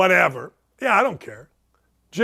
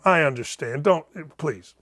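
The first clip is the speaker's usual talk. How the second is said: ordinary delivery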